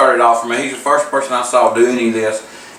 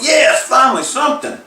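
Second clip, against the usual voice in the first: excited shout; 10 semitones higher than usual. Retold or imitated. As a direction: cheer like you finally won. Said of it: "and I was like".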